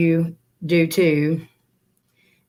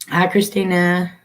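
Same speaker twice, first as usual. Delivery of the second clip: ordinary talk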